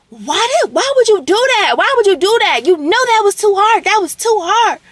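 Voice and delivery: high, loud, pained pleading; distressed high cry. Retold or imitated. Roommate imitated wailing at her boyfriend. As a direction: plead in a hurt, high-pitched wail. Roommate crying out during fight.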